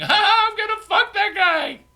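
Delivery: very high, very loud screamed threat; wild scream. Retold or imitated. Crowd at the rock pile screaming, wild scream. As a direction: shriek it like a rowdy crowd. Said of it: "they're screaming".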